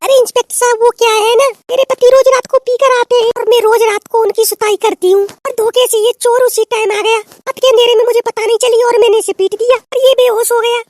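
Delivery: loud excited telling. Acted. Wife explaining to the inspector, loud and high. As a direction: blurt it all out loudly.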